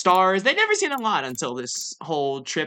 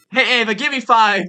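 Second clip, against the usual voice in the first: loud, higher celebratory call; excited cheer; 5 semitones higher, 8 dB louder than usual. Pretend. Player greets Ava through the screen, excited. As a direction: call out for a high five, thrilled.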